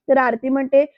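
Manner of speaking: usual speaking voice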